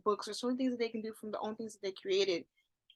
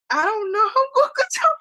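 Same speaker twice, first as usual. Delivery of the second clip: high, loud laughing through words; 11 semitones higher, 13 dB louder than usual. Real, not acted. Laughing at what is coming.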